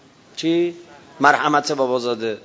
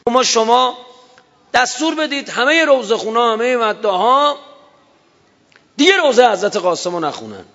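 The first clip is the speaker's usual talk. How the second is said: put-on boy's loud plea; 9 semitones higher, 4 dB louder than usual. Boy loudly pleading with the leader.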